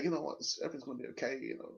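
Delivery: hurried, hushed, half-mocking reassurance. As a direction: brush it off like a soft captain. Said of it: "a captain who's gonna say".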